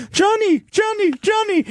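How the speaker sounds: high, desperate calling out; pleading woman. Retold or imitated. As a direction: call his name high and pleading. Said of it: "who's just like".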